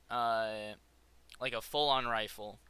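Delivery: normal talking voice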